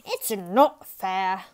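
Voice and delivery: slow, loud, indignant complaint; furious protest. A narrator's reading. Ratso complains the other salon isn't fair, indignant and loud. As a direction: stretch it out in outrage. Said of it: "They were furious".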